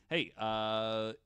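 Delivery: normal talking voice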